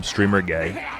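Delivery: usual speaking voice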